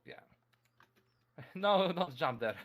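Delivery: ordinary delivery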